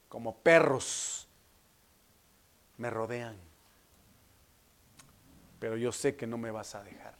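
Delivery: low, solemn prayer to the Father; subdued, weighty voice of resolve; Jesus praying. Acted. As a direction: pray with grave trust, pausing between phrases.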